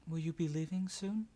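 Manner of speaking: put-on little girl voice.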